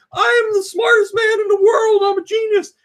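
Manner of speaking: high, booming, self-important boast; pompous genius. Retold or imitated. Mocking the doctor's boasting, a high loud brag. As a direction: puff up and proclaim your brilliance. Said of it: "Doctor Octavius is like".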